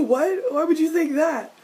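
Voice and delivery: high, loud baffled outburst; exaggerated high-pitched incredulity; shocked disbelief. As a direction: squeak it out in mock shock. Acting shocked reply to line.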